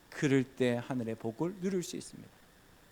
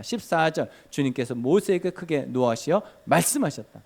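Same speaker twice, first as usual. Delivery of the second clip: loud, emphatic verse reading; preaching scripture recital; 5 dB louder than usual. Acted. Pastor reading verses loudly to the congregation. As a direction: call out each verse to the flock.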